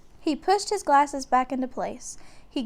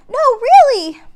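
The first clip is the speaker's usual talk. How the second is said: very high, loud frantic insistence; scared girl insisting; frightened insisting; 13 semitones higher, 15 dB louder than usual. A narrator's reading. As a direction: beg him to believe her. Annie insists in fear.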